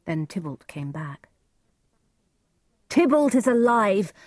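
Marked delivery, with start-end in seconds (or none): 2.9-4.1 higher, impassioned outcry; crying out. A narrator's reading. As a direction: burst out in outraged protest. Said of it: "cried Romeo".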